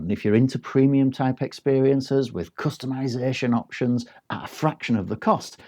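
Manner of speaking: normal talking voice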